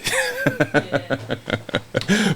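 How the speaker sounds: a soft, high laugh breaking out; bursting laugh. Real, not acted. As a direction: crack up at what he said. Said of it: "I just broke out laughing".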